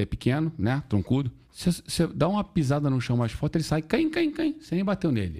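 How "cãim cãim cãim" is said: high yelping cãim, cãim like a kicked little dog; mock puppy yelps, pitched way up; dog yelp imitation. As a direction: squeal the cãim like a yelping bulldog. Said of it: "ele saiu latindo".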